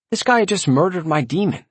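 ordinary delivery, nothing marked

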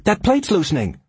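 high, urgent cry; screamed warning. A narrator's reading. Linda warning the captain about the plate, screaming. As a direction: shout the warning with pitch way up. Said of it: "screamed Linda".

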